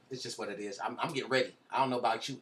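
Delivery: usual speaking voice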